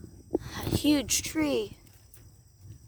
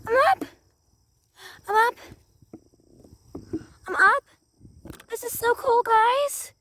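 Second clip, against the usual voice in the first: excited; 10 semitones higher, 5 dB louder than usual. Pretend. Excited after climbing the tree.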